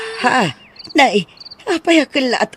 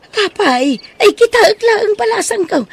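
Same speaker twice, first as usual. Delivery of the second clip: loud, high, alarmed cry of shock; 9 semitones higher, 6 dB louder than usual. Acted. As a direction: voice jumps up in alarm at what she sees. Nana sees child's bruised armpit. (nakigtot nga ikkis)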